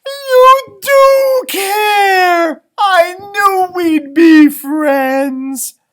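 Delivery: high, loud, slow and crying with joy; overjoyed dog crying loudly; tearful joy. Acted. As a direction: sob it out happily at your friend.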